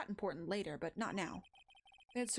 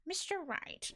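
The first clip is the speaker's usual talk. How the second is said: high-pitched character voice, falling at the end; rejected voice attempt; 9 semitones higher, slower than usual. Shown as a demonstration. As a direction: try out a high game-character voice. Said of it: "Nope, that's not it".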